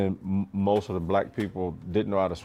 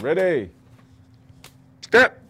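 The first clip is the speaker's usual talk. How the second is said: sharp barked order, much higher and louder on step; drill instructor command; 9 semitones higher, 6 dB louder, slower than usual. Retold or imitated. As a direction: bark it like a drill instructor on the platform. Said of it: "when that drill instructor said".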